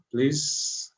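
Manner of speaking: ordinary talk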